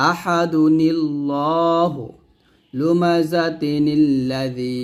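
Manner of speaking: very slow, linked আহাদুনিল্লাহু with added নি; joined recitation with nun qutni. Shown as a demonstration. Teacher demonstrating connected reading, very slow. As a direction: draw each joined word out slowly, nun linking into lam. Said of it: "না করি তাহলে এরকম হবে".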